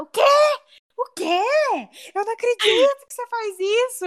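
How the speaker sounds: high, scandalised disbelief; shocked grandma. Retold or imitated. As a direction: voice the grandma refusing to believe it.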